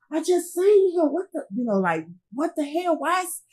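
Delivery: high, anguished mock yell of a shocked cop; retold hollering cop. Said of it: "I could hear the police hollering and crying".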